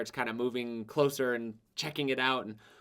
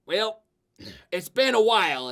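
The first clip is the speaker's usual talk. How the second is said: put-on higher, hearty old braggart; boastful old adventurer; 8 semitones higher, 7 dB louder than usual. Retired adventurer sizing up his new partner, hearty. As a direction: size up the pipsqueak with a chuckle. Tuck voice.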